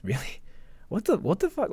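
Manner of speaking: ordinary talk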